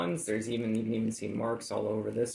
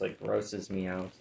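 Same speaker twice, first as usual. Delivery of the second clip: usual speaking voice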